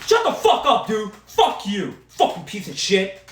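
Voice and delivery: high, loud furious yell; angry yelling. Real, not acted. Fan yelling insults at the quarterback, high and loud. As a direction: tear into him.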